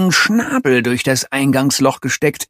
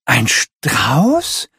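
ordinary delivery, nothing marked

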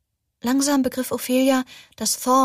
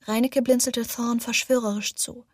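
plain reading voice

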